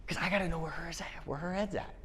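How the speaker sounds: breathy confiding whisper. Real, not acted. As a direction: lean in and tell it like a secret. Confiding about weekly meetings.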